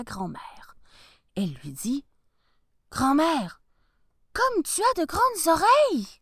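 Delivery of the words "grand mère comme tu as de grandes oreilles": put-on little girl voice. Girl wonders at grandmother.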